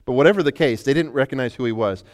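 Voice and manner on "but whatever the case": usual speaking voice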